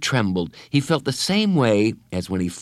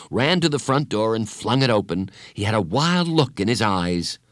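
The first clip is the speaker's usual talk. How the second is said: plain reading voice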